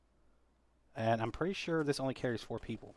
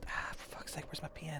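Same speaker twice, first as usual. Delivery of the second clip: whispering; 4 semitones higher, 7 dB quieter than usual. Real, not acted. Searching quietly before stepping off.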